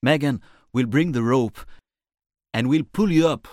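high, projected calling voice; loud reassurance. Acted. Narrator promising Reese a rescue, loud reassurance. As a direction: call the plan down to someone below.

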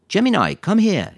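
loud, high, slow command; calling dog. A narrator's reading. Quentin tries ordering Lisa's dog over, calling out. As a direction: call the dog over firmly.

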